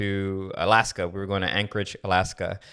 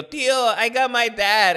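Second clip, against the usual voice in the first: put-on much higher, gleeful kid voice; gleeful kid boast; 12 semitones higher, 5 dB louder than usual. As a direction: crow like a kid who won a prank. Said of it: "he goes to his tío".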